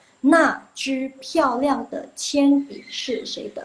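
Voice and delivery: 那支漂亮的铅笔是谁的 read slowly, each word clear; slow, careful model reading; slow model sentence. Shown as a demonstration. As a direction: recite it slowly like a model for students. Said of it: "Jadi ingat ya".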